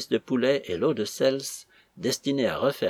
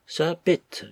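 higher, firm form of address; mildly forceful narrated interjection; 6 semitones higher than usual. A narrator's reading. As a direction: break in with steady resolve. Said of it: "sans que sa voix perdît de sa fermeté".

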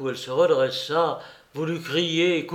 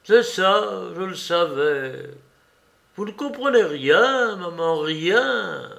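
sharp and raised, then slow on "maman, rien"; angry retort; 5 semitones higher, 3 dB louder, slower than usual. A narrator's reading. Shivering prisoner snapping at his mother, angry. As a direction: snap at her, then drag the last words out. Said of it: "lui dit d'un air courroucé".

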